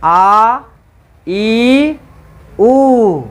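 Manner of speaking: आ, ई, उ each drawn out with extra force; teacher stretches आ, ई, उ to twice the length; long vowels demo. Shown as a demonstration. Teacher demonstrates long vowels to class, held and stressed. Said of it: "इसका भी मैं उच्चारण कर रहा हूँ".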